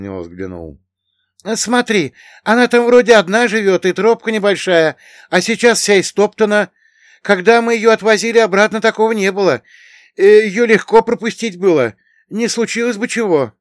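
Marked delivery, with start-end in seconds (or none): 1.5-13.5 high, loud, anxious character voice; Bogdan, worried villager guide. A narrator's reading. Bogdan pointing out the trampled path to his companion, worried.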